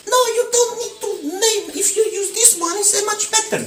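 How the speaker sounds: mock girlfriend voice. Boyfriend mimicking the girlfriend's cookie advice, high mock voice. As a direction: squeak it out like the girlfriend correcting you. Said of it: "she's like".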